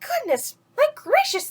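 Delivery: very high astonished cry; amazed exclamation. Acted. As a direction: gasp it like a stunned crowd. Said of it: "they shouted".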